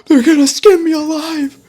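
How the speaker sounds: high, loud, frantic wailing; losing it completely in fear; frantic panic. A narrator's reading. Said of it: "Slago started panicking".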